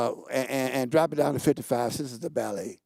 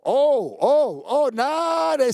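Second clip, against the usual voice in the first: excited; 12 semitones higher, 6 dB louder, much slower than usual. Real, not acted. Reacting to crowd calls.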